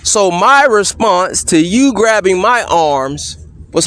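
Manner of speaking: usual speaking voice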